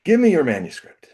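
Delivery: the mentor impatiently demanding the manuscript; eager demand. Retold or imitated. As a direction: hand it over, now. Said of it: "And he goes".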